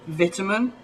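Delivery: "vitamin" with a short i, VIT-uh-min; English pronunciation. Shown as a demonstration. YouTuber giving a common English example, British pronunciation. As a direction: say "vitamin" the British way, first syllable like "bit". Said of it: "gonna say them the English way".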